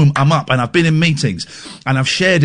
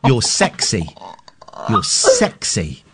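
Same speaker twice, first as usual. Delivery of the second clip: mock sultry; 8 semitones lower, much slower than usual.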